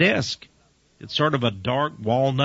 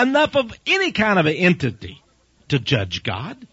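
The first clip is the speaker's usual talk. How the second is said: rising preacher fervor; 6 semitones higher than usual. Acted. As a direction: push each phrase up in heat. Preacher building to a peak.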